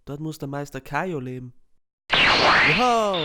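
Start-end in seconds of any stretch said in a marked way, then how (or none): 2.1-3.2 loud, high amazed cry; excited wow. Acted. Amazed fighter shouting wow. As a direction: burst out in awe. Excited exclamation in abridged parody.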